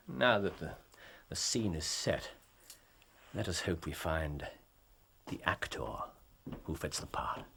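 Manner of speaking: soft, low, nearly whispered musing; quiet aside before the suspects arrive; murmured aside. Acted. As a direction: mutter it to yourself. Murmured aside before visitors enter.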